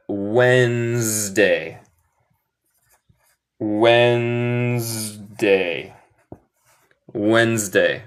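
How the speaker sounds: Wednesday as "WENZ-day", silent first D, drawn out; correct pronunciation. Shown as a demonstration. Teacher demonstrates a hard-to-spell word, slow correct pronunciation. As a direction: stretch "Wednesday" slowly into two syllables, wenz-day. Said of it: "pero se pronuncia".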